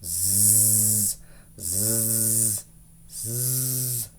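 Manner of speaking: quiet, low snores through the nose; girl snoring away in bed; soft snoring. Acted. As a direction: snore softly in deep sleep. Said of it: "went to sleep on the floor".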